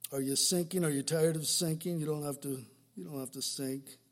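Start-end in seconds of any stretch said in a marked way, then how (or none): none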